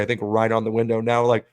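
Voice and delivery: ordinary talk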